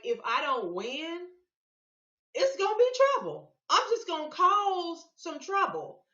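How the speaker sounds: hammed-up threat of trouble; imitating Trump's warning with heavy emphasis; emphatic Trump quote. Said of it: "he put emphasis on it".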